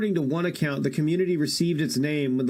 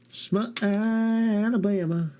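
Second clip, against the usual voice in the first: the town name in a hammed-up Southern twang, pitched higher; mock Southern drawl; 6 semitones higher, slower than usual. Streamer to chat, mocking the name in a Southern twang.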